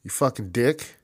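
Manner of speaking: a pretend furious call to Bruce; mock angry phone call. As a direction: tell him off down the phone. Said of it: "I would call him myself and go".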